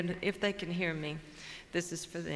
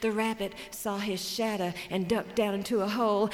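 ordinary delivery, nothing marked

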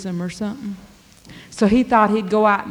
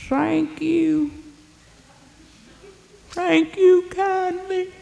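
put-on much higher, slow, frail old beggar voice; old beggar man; 8 semitones higher, much slower than usual. Beggar thanking the blacksmith for letting him in, high and frail. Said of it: "said that old beggar man".

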